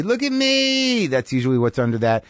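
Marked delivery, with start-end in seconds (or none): 0.0-1.1 high, slow look-at-me voice; attention-seeking whine. Retold or imitated. Comic decoding the woo, high needy plea. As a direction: drag it out like begging for attention. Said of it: "that can be".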